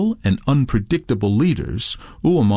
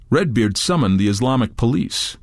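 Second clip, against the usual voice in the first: plain reading voice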